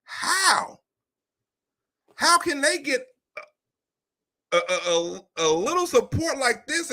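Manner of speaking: stunned by their numbers; mock disbelief at rivals' support; incredulous. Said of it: "I'm like".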